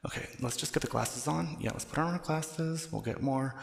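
ordinary talk

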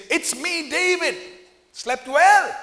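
David teasing Saul from across the hill; cheeky taunt. Retold or imitated. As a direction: needle the sleepy king. David taunting the woken king.

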